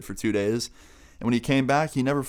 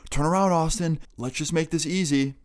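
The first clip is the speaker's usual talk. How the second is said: higher-pitched retold call; raised pitch without full shouting; calling after someone; 6 semitones higher than usual. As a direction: imitate a pursuer calling out. Pursuer calls after him.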